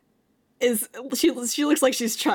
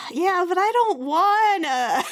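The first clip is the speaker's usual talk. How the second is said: whiny; 7 semitones higher, 5 dB louder, slower than usual. Real, not acted. Player whines about opening up.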